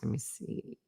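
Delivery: unvoiced whisper while scrolling; whispered to herself. Real, not acted. Host searching her phone, whispering to herself. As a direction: whisper it to yourself as you look. Muttering while checking texts.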